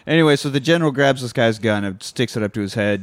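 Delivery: usual speaking voice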